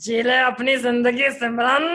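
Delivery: lightly voiced stern Bollywood father; Amrish Puri. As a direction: grant permission like a stern patriarch. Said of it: "I can do an Amrish Puri".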